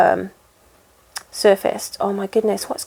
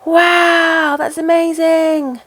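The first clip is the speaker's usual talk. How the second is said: high, drawn-out gushing disbelief; mock amazement; 8 semitones higher, much slower than usual. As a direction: act out an astonished viewer, pitched way up. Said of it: "I'm gonna shock you".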